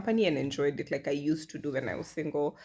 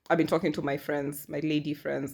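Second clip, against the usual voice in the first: ordinary delivery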